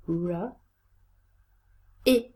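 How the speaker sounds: r then short i, each said alone; isolated sounds. Shown as a demonstration. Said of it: "look at the sounds in those syllables".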